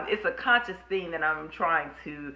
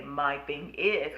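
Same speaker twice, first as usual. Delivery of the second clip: drawn out slowly, each word weighed; "my thing is" said slowly with a falling end; slow deliberate catchphrase; 3 semitones lower, 7 dB quieter, much slower than usual. Shown as a demonstration. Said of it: "something about saying".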